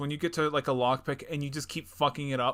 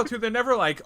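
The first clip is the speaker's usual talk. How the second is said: ordinary talk, nothing marked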